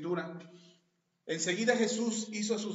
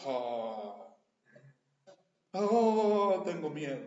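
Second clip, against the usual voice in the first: long, drawn-out "oh", then a tame admission of fear; badly faked manly cry of fear; mock manly fright; 2 semitones lower, 6 dB louder, much slower than usual. Preacher mocks how grown men would yell, half-hearted fright. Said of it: "¿A poco los hombres gritan".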